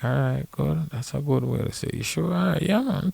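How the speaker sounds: high-pitched, easygoing mock agreement; imitated agreeable reply. Caddy accepting the player's promise, in a high agreeable retelling. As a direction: act out going along with it, voice lifted high. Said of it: "I'm like".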